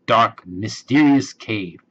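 usual speaking voice

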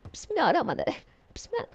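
alçak sesle korkmuş dua; korkuyla fısıltı dua. Real, not acted. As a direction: nefesinin altında korkuyla dua et. (korkan oyuncunun kendine fısıldadığı dua)

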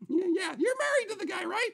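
high put-on diva voice. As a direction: chat up high as the star guest.

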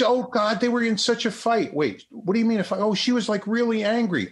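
high, breathless mock agitation; lightly imitated upset assistant; agitated assistant. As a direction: play the shaken assistant, pitch way up. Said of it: "came back in tears".

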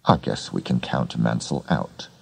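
low, soft and even, a quiet verdict. A narrator's reading. Rose Noble quietly writing off his rival. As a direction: let it drop low and quiet, almost to himself. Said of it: "said Rose Noble quietly".

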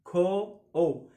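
teacher isolating the o sound; letter sound drill. Shown as a demonstration. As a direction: isolate the long o for the class. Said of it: "حرف الأو هنا بنطقه باسمه".

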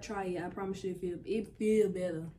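normal talking voice

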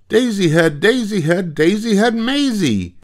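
very high, taunting schoolyard chant; crazed kids' shouting. Acted. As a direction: chant it like a roomful of wild kids. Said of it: "started shouting like crazy".

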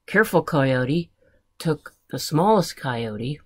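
ordinary delivery

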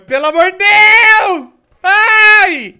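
loud frightened cries; being chased. Real, not acted. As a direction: shout in panic, slowly.

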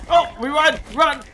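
high shouted run call; panicked yelling. Real, not acted. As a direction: yell it in panic. Fleeing monster in game.

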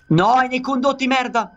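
real gamer frustration; frustrated outburst. Real, not acted. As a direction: blurt it out at the game. Finds Springtrap in the vents.

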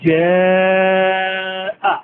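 জাআ held very long; four-alif stretch. Shown as a demonstration. Teacher demonstrating the long madd on জাআ. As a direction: pull the vowel of জাআ out fully. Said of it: "teacher demonstrating".

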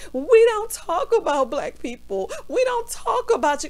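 high, climbing mock wail; mock boo-hoo crying. [name] protesting at the brunch, mock boo-hoo crying. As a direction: imitate someone whining through tears. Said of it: "[name] is boo-hoo crying".

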